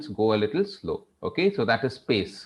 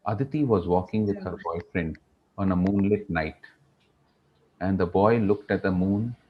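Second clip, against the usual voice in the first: slow and soulful; 6 semitones lower than usual. Shown as a demonstration. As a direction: tell it tenderly, unhurried, pitched down. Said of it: "So this was soulful. This was something which required sensitivity".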